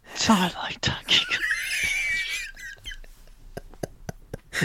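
breath-only, hushed mock killer's voice; muffled masked whisper. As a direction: whisper it as if under a mask. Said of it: "He said that under his mask".

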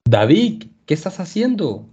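surprised call, rising high; narrated shout. A narrator's reading. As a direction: call out to David, puzzled. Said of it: "gritó uno de los hombres".

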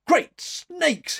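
loud, very high burst of astonishment; startled exclamation. A narrator's reading. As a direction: blurt it out, thoroughly taken aback. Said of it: "he ejaculated".

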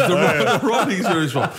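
real chuckle in the voice; laughing through it. Real, not acted. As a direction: push the punchline out through laughter. Finishing his joke while laughing.

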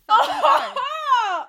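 loud, high, drawn-out laughing cry; excited shout. Real, not acted. Host delighted by the unlucky thirteen coincidence, excited laughing shout. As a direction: shout it slowly through laughter.

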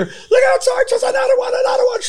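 very high shouting alarm; retold alarm shout. Retelling the scene, he voices Tim's very high alarm shout. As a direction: yell the warning. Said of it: "all of a sudden here".

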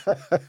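brief high-pitched chuckle; short laugh. Real, not acted. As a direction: let out a quick amused laugh. Amused by the interviewer's question.

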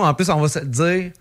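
ordinary talk, nothing marked

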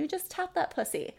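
mock British accent, lightly done; a playful British accent impression. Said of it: "in her British accent".

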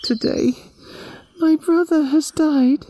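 put-on a little girl heartbroken over her brother; Yami grieving. A grieving girl answering the animals, high and sad. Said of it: "Yami told them".

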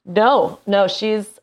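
ordinary talk, nothing marked

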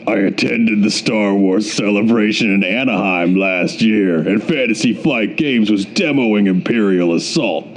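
low, gruff letter-writer voice. Acted. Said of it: "You're gonna be gruff".

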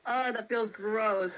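ordinary delivery

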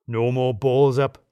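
slow, drawn-out toast call. Acted. Lem raising his glass to the team, slow toast. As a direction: raise the glass and draw it out.

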